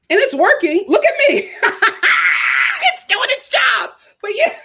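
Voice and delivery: high giggling brag about the energy kick; laughing. Real, not acted. To viewers, showing off the pre-workout kick, giggling. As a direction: laugh through it, proud of the buzz.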